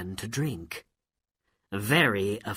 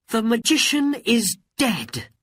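excited; 12 semitones higher, 6 dB louder than usual. Acted. Aladdin relieved.

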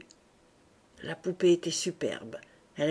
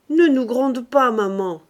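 bright, loud and playful plea; cheerful; 5 semitones higher, 9 dB louder than usual. A narrator's reading. Son cheerfully begging his mother not to scold, louder. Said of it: "dit gaiement Octave".

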